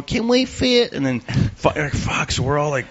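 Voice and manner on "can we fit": put-on higher girlish voice; another girl asking. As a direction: ask politely, pitched well up. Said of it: "And then the tenth floor".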